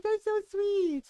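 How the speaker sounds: high, drawn-out mock aww; retold sweet gush; touched gushing. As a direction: imitate a friend melting, slow and high-pitched. Friend's touched reaction, retold.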